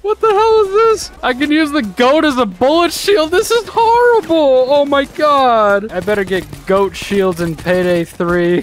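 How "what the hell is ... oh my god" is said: much higher, incredulous squeal; shocked disbelief. Real, not acted. Seeing the goat used as a shield, high shocked squeal.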